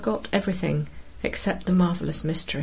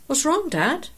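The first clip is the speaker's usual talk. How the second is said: put-on higher-pitched, rising boy's question; son's question; 9 semitones higher than usual. A narrator's reading. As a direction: ask Dad as a puzzled son. Startled son questioning his father.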